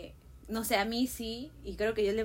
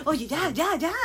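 higher, louder imitation of herself urging on; fired-up cheering; 4 semitones higher, 5 dB louder, slower than usual. Player hurrying her teammates at a match, fired-up. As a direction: urge the team on, hammed-up. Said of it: "el que me conoce sí sabe que soy como que".

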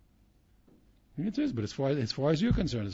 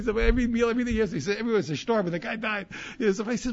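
laughing; 8 semitones higher, 8 dB louder than usual. Real, not acted. Retold, laughing, loud.

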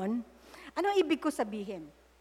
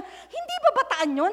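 quoting friend; 9 semitones higher than usual. Retold or imitated. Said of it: "inaalala niya".

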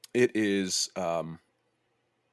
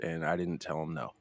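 ordinary talk, nothing marked

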